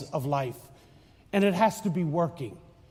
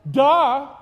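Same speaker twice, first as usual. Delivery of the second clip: louder, sarcastic duh; mock duh; 4 semitones higher, 5 dB louder than usual. Real, not acted. Priest mocking the scholars, sarcastic duh. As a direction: scoff at the obvious.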